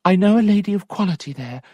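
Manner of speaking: fast, high Sophia; eager young lady. Acted. Sophia explaining to her maid, fast and high. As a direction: rush out the plan.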